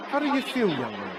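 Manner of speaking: lightly put-on inquisitive music teacher; teacher's probing question. Voices the teacher questioning him.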